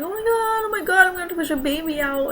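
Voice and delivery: high, fast mock panic; panicked retelling. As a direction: replay the phone-call freakout, rushed. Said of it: "I started to cry last week".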